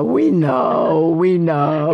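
loud, mock fed-up reply; imitated exasperated son. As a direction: play a kid who has heard it too often. Imitating her son's weary reply.

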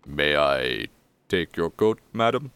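lightly voiced, halting butler; a touch of the formal servant; disheveled butler. Butler asking madam for her coat, lightly formal. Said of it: "and he goes".

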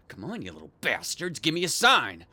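usual speaking voice

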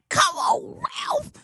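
high, rising yell of protest; exasperated shout. Retold or imitated. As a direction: scream his name in disbelief. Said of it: "I was like".

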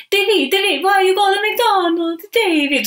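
put-on high, loud puppet grandma with a hammy Italian accent; bad Italian nonna accent. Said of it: "Sorry for my horrible accent".

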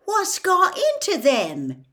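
loud, very high, drawn-out question; incredulous outburst, stretched out; baffled demand. A narrator's reading. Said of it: "he demanded".

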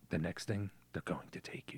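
low hushed warning. Retold or imitated. Father warning his daughter in a hush. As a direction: warn her quietly. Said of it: "he's like".

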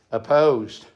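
ordinary talk, nothing marked

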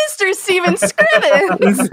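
chorus the villain's name through laughter; hammed-up cartoon reveal, laughing; mock group exclamation. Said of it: "At all the same time, we're all like".

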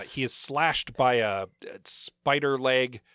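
usual speaking voice

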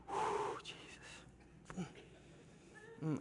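breathless, whispered groan of pain; a whispered Jesus; weak groan. Real, not acted. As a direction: exhale it like a sick man. Waking sick at night.

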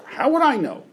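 high, sharp, dismissive "How would I know?"; curt retort. Shown as a demonstration. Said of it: "I didn't say it that way".

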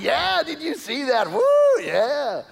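high, excited crowing; boy celebrating with his teammates; mock celebrating. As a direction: cheer like a kid high-fiving. High-fiving teammates after goal.

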